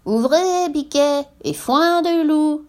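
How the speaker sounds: high, drawn-out singsong falsetto; wolf faking a she-goat's voice; imitating mother goat. A narrator's reading. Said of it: "en imitant la voix de la chèvre".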